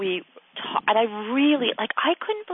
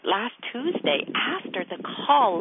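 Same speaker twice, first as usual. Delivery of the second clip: normal talking voice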